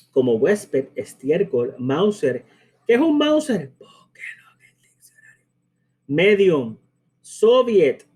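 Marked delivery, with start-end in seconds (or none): 2.9-3.7 high-pitched mock puzzled question; mock student question. Teacher voicing a student asking about a word, high mock question. 3.8-5.5 barely audible whispered instruction; whispered aside. Acted. Teacher answering the imagined student's question, whispered aside.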